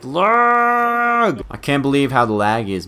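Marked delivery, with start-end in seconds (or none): 0.0-1.4 high, drawn-out cry; exasperated wail. Real, not acted. Player blaming the lag, high exasperated wail. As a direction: wail it out in protest. Complaint about lag, escalated take.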